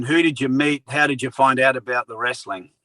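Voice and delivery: usual speaking voice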